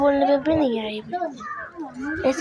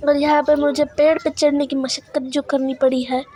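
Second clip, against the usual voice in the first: ordinary talk, nothing marked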